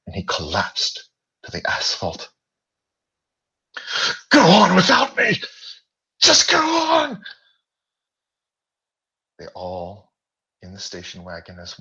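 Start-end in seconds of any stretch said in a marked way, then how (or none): 4.3-7.3 very high, loud, crying plea; desperate cry. Acted. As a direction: beg them to leave you behind. Bill, collapsed, begs his friends to leave.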